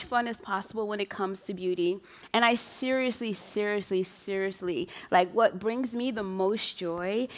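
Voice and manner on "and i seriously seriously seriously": each seriously softer and lower; fading repetition. Real, not acted. Founder stressing her joy, seriously fading each time. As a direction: let the seriously trail away.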